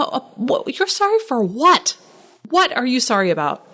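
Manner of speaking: rising disbelief at all the apologising; baffled, pitch jumping up on sorry for what; incredulous what. Real, not acted. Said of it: "I'm not trying to raise my voice".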